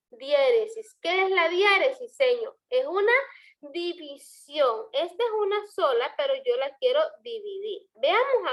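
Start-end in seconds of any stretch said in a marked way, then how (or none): 3.6-4.9 DIVISIÓN slowly broken into di, vi, sión; syllable by syllable. Shown as a demonstration. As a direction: stretch división out, dividing each syllable. Teacher illustrates dividing into syllables.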